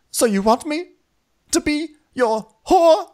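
high, halting, incredulous question; mock wounded knight. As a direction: play a hurt suitor, drawing out the insult. Imitating the offended knight.

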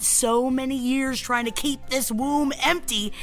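chatty sponsor read over music. Acted. Host reads a fertility test sponsor ad to listeners. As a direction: sell it with jokey asides.

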